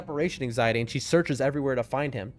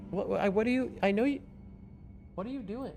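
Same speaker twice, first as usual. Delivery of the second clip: puzzled murmur to a cat; 5 semitones higher, 8 dB quieter, faster than usual. Real, not acted. Baffled by cat, talking to it.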